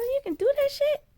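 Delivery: high-pitched astonished question; stunned that it's even possible. Retold or imitated. Retelling disbelief at God.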